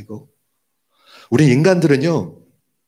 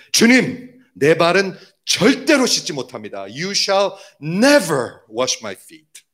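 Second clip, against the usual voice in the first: higher-pitched, forceful protest with NEVER punched; emphatic retold refusal; 4 semitones higher, much slower than usual. Peter refusing Jesus' washing of his feet, emphatic protest. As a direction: protest firmly, leaning hard on 절대로. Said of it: "굉장히 강하게 얘기한 거예요".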